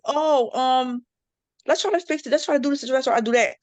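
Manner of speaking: high, gentle coaxing; gentle fixer. Retold or imitated. Soothing a friend after a blunt remark, gentle coaxing. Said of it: "I try to be a little bit more like".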